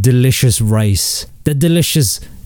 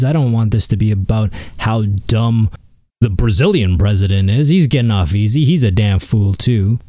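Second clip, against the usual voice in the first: ordinary talk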